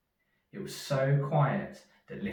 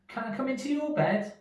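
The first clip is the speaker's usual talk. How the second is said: high, pleading little mouse; 4 dB louder than usual. Acted. Little Mouse asks Big Mouse to share his bed: high pleading voice. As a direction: beg sweetly in a tiny voice.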